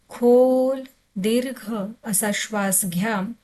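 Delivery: ordinary delivery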